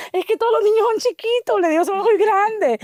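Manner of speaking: a hint of a whining schoolgirl; child's whine. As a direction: imitate her small self protesting. Child complains to the señora.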